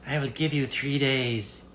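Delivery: a sly little goblin bargaining; impish little man. Acted. Little man bargains with the girl, high and impish. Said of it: "he said".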